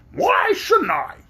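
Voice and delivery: high, slow, pecking hen voice; sharp high hen; sharp, clear hen voice. Acted. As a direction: snap it like a proud hen. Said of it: "asked the hen in a sharp, clear voice".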